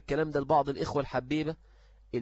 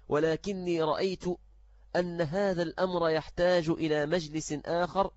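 usual speaking voice

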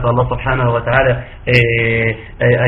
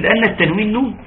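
quoting a student; 7 semitones higher than usual. Retold or imitated. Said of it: "قالت".

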